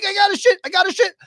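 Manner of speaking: very high, urgent retold panic; panicked cry. Husband to wife while racing upstairs, high panicked squeal. Frantic run past wife to bathroom.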